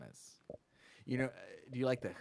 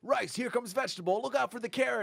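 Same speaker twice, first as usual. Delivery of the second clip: playful cartoon voice, pitched up; silly cartoon voice; 9 semitones higher than usual. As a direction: voice the veggies like a kids' show. Playing out food characters.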